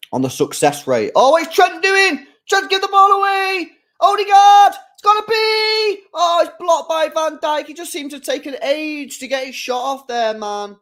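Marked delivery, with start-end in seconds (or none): 1.2-10.8 much higher and louder, yelling at the screen; excited match reaction. Real, not acted. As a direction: lose it over the attack as it unfolds. Live reaction to a match chance.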